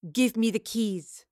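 slow, deliberate order; demanding. A narrator's reading. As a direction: press the demand word by word. Standoff, intruder faces demand for keys.

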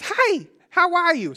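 very high, slow sing-out greeting; pep up like a delighted toddler at the mirror; imitating toddler son. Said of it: "It's exactly how he says".

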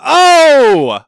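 loud, high, drawn-out imitation catchphrase; mock comedian's big "Oh!"; Andrew Dice Clay catchphrase. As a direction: belt out the comic's trademark cry. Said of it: "you know bad impressions of him".

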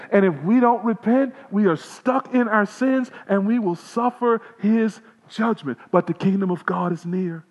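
impassioned sermon voice; fiery preaching. Acted. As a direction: preach it hard to the congregation. Full-force gospel preaching.

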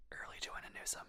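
retold sly whisper, very soft; whispered aside. Critic retells teasing a friend at the movie, whispered. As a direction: lean in and whisper it. Leans over to whisper a burn.

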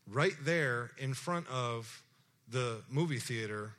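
ordinary talk

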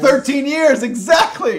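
loud, high burst of agreement; excited shout. Real, not acted. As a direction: shout it out in glee. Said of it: "excited agreement".

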